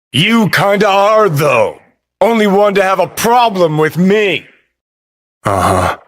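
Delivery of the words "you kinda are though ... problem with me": high, pressed argumentative voice; heated accusation. Acted. Arguing with the one who has a problem, heated. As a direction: throw it back at them, pitch raised.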